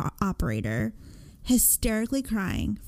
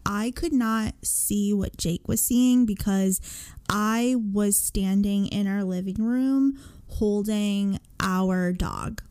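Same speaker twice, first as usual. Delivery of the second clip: ordinary talk, nothing marked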